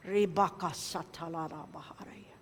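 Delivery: breathy speaking in tongues; hushed glossolalia; whispered tongues. Retold or imitated. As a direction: murmur tongues under your breath. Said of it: "I can no longer speak Tagalog or English or tongues".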